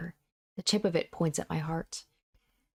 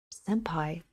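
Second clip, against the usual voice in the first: whispering. Acted. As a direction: breathe her name in a whisper. Calls softly to crying girl.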